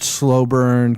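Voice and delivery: usual speaking voice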